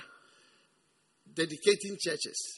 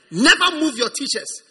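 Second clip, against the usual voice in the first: ordinary delivery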